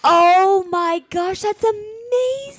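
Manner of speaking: high, slow tearful excited cry. Real, not acted. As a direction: let the joy almost overwhelm you. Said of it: "I'm so excited".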